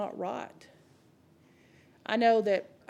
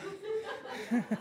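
high laugh; 8 semitones higher, 8 dB quieter than usual. Real, not acted. Laughs at his own joke.

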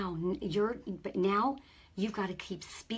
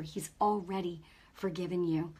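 ordinary talk, nothing marked

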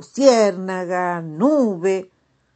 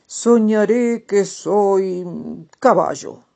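slow, louder dreamy toad; storybook toad voice; 3 semitones higher, 7 dB louder, slower than usual. Acted. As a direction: tell the dream slowly, savoring it.

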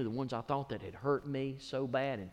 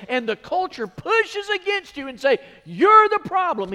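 much higher and louder, near shouting; preaching at full force; 11 semitones higher, 13 dB louder than usual. Real, not acted. Pastor urging church to stand on God's Word, shouting.

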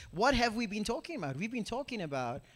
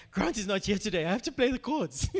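laughing through a retold thought, a little louder; 3 dB louder than usual. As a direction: chuckle as the words come out. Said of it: "I was laughing".

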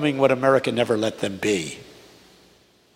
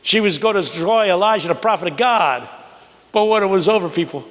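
high, rising preacher's cadence building to a cry; full-force preaching; 8 semitones higher than usual. Acted. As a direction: preach it like a Baptist pulpit. Preaching the sermon retold.

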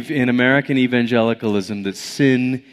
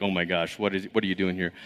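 low, soft mock-panicked murmur; hushed panic; 8 semitones lower, 11 dB quieter, faster than usual. Imagining the doctor's alarm, hushed mock panic.

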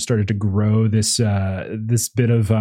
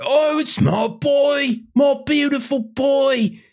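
very high, slow crone's cry; overjoyed old mother; slower than usual. Acted. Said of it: "you hear the voice".

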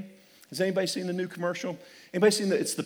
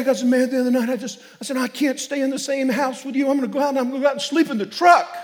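angry husband; 8 semitones higher, 6 dB louder, faster than usual. Retold or imitated. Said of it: "He said".

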